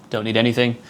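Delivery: usual speaking voice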